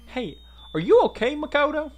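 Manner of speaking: put-on high, loud first try at a Southern girl; concerned, high-pitched tentative Southern accent; searching for Southern voice. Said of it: "There's my southern voice".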